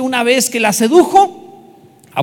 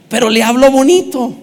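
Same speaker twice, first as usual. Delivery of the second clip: pero le habló bonito through a laugh, louder; 3 semitones higher, 5 dB louder than usual. Shown as a demonstration. Preacher repeating the punchline laughing with the congregation. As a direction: chuckle the punchline.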